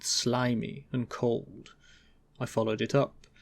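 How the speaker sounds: usual speaking voice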